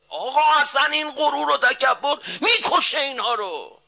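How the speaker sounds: high, loud sermon lament; preaching at full force, pleading; impassioned preaching. Acted. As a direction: swear it to the crowd with fervour.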